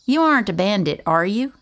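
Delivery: hope he says yes; hopeful question. A narrator's reading. Girl asking the stranger hopefully if he is a bandit. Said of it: "she asked hopefully".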